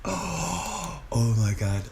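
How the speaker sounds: low, soft, breath-filled reaction; stunned hush. Real, not acted. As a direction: say it under your breath, stunned. Stunned by the footage.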